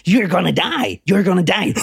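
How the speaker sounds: repeated demonic chant, like a stuck record. Retold or imitated. Telling the story, imitates the caller's demonic chant. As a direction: drone it over and over like a demon. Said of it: "a record that's on repeat".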